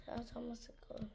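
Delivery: whispered aside under the breath. Real, not acted. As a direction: whisper it so nobody hears. Quiet aside while playing.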